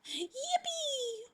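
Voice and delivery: soft, very high squealed yippee; little high-pitched squeal of "yippee"; tiny drawn-out squeal of glee; gleeful cheer. Acted. Celebrating the dynamite in game.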